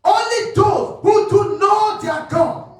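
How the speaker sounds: fiery preaching. Real, not acted. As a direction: preach it out with full force. Preaching at full force.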